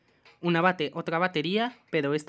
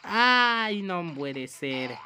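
exasperated dismay; much slower than usual. Pretend. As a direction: groan it out in slow defeat. Fails again in the game.

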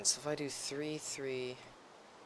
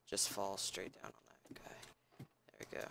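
whisper; 3 semitones lower, 6 dB quieter than usual. Real, not acted. Sneaking as assassin.

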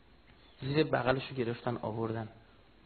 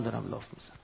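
usual speaking voice

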